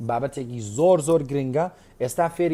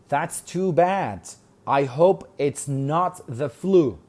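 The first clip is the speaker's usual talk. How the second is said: slow model line; much slower than usual. Shown as a demonstration. Teacher reading Tracy's reply slowly to class. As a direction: read slowly, each word clear. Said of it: "slow reading".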